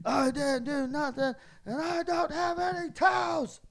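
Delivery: loud mock wail climbing high; yelling protest. Drunk housemate coming back with a towel, climbing mock yell. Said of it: "yelling at me".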